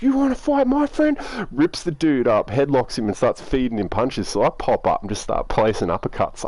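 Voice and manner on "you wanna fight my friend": high-pitched mock fight challenge; confrontational, pitched way up; acted challenge. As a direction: play the friend squaring up for a fight. Friend steps in to fight.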